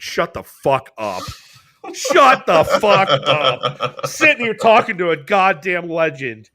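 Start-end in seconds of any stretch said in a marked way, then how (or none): 0.0-4.0 slow, louder, laughing disbelief; stunned disbelief. Retold or imitated. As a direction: drag it out, stunned by a legend. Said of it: "And I was like".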